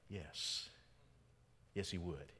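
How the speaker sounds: ordinary delivery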